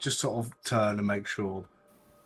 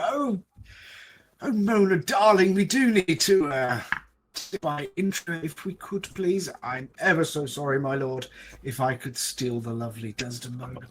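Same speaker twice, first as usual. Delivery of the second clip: high, lilting posh society voice; 5 semitones higher, 4 dB louder than usual. Acted. Player steals Desdemona away from a lord in posh voice. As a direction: gush it like a posh hostess. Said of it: "impersonation of a posh person".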